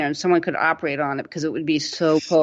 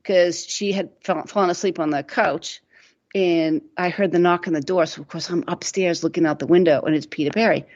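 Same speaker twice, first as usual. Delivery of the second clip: ordinary delivery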